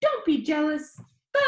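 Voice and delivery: high, loud, chatty vlogger; high squeaky boy. Acted. Danny tells his viewers about his disease in a high squeaky voice. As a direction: rattle it off like an upbeat kid vlogger. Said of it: "His voice was high and squeaky".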